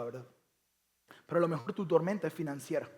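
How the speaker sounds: ordinary talk, nothing marked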